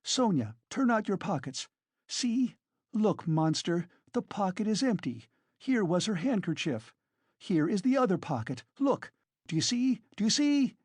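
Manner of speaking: high-pitched, agitated woman; frantic Katerina. A narrator's reading. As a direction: prove innocence in rising triumph.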